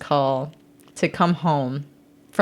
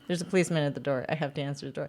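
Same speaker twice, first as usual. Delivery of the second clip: hushed reply; 3 semitones lower, 9 dB quieter, much faster than usual. Retold or imitated. Sister hissing back at brothers.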